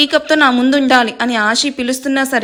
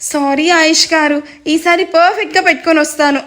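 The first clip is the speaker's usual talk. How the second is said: fake sweet apology; 4 semitones higher than usual. Wife sweetly apologising to husband while plotting revenge, mock-sweet. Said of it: "అని నవ్వుతూ".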